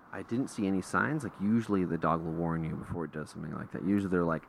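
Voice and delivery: ordinary talk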